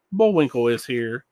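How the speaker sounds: usual speaking voice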